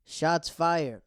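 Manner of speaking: loud, high hype call; hyped up. Real, not acted. Hyping the drama.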